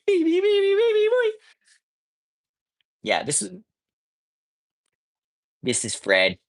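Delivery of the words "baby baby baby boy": high cutesy pet voice. Real, not acted. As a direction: coo at the cat in a tiny high voice. Cooing at the cat.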